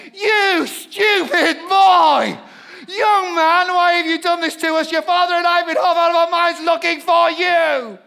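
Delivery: Mary yelling at her runaway son in the temple; frantic mother's shout. Shown as a demonstration. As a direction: shout it, half out of her mind with worry. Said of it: "I have a feeling she said".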